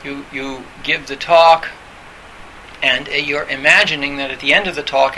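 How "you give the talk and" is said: normal talking voice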